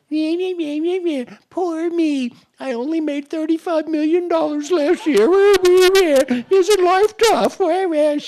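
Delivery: nasal mock-whining millionaire; whiny complaining CEO. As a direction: mimic a spoiled rich client's moaning. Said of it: "Sometimes they whine and complain".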